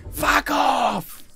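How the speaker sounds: very high, strained crowd heckle; mock crowd shout. Wedding crowd answering the podcast host, mock high-pitched shout.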